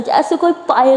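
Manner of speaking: ordinary delivery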